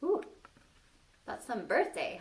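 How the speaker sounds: ordinary delivery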